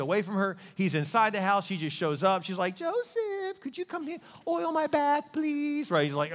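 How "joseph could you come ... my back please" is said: mock sing-song temptress, very high falsetto; seductive woman impression. Potiphar's wife luring Joseph over, high mock-seductive falsetto. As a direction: coo it in an exaggerated high female voice.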